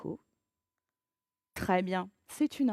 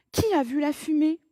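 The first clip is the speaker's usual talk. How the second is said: urgent question; 5 semitones higher, 6 dB louder than usual. Acted. Urgent question in fire scene.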